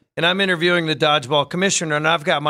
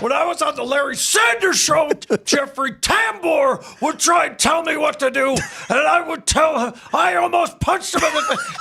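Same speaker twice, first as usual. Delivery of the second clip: hammed-up high, strained tirade; Rip Torn; 8 semitones higher than usual. Actor ranting about his Larry Sanders days, mock furious shout. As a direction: rant like an outraged old-show actor. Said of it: "And he goes".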